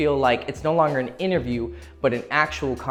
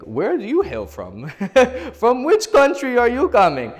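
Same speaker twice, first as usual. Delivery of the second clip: hammed-up old-fashioned English accent, much higher and louder; 11 semitones higher, 6 dB louder than usual. Man mocking a weird English-accented pickup line in English accent. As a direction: camp English gentleman asking with a laugh. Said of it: "like, English accent voice came to me".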